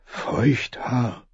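soft, hushed murmur; innkeeper's hushed aside. A narrator's reading. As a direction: murmur the name under your breath. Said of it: "hörte den Gastwirt murmeln".

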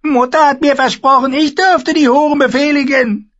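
high, loud whining brat; whiny boy king. Acted. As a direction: whine like a spoiled child. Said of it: "quengelte Joffrey". (quengelnder Kinderkönig)

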